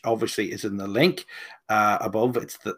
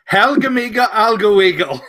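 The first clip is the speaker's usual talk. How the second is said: a hammed-up fake Swedish gibberish, higher and louder; mock Swedish voice; 7 semitones higher, 6 dB louder than usual. Host showing his Swedish impression, hammed-up mock Swedish. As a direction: put on a silly Swedish accent for the host. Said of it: "can I do the voice?".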